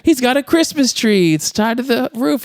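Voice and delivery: high-pitched put-on kid voice; imitated chattering child. Little girl telling her mom about dad, imitated high child voice. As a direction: report it like an innocent little kid.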